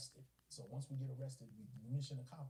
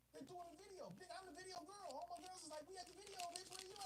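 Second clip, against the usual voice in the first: much higher, softer girlfriend imitation; girlfriend on phone; 5 dB quieter than usual. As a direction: put on a high voice as the insistent girlfriend.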